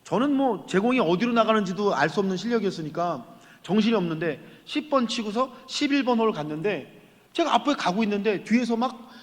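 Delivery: usual speaking voice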